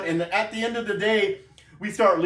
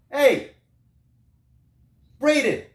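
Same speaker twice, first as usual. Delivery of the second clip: raised, higher-pitched call to a coworker; 7 semitones higher, 4 dB louder, slower than usual. Retold or imitated. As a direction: call him over from across the room. Retold calling to a coworker.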